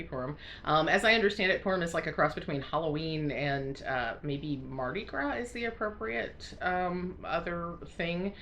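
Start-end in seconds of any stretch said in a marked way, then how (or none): none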